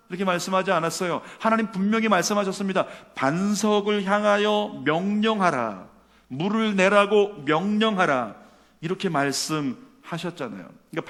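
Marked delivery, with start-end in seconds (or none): 3.1-8.3 slow, loud, deliberate quoting; proclaimed divine command. Retold or imitated. Preacher quoting God's exact words to Moses, slow proclamation. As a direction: deliver each word as a weighty order.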